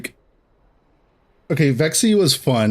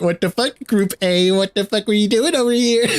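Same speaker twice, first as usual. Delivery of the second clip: laughing mock taunt; 8 semitones higher than usual.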